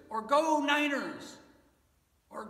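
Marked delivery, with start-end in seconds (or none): 0.2-1.4 high, loud fan yell; sports cheer. Acted. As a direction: cheer for your team from the stands. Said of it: "We cheer for that a lot".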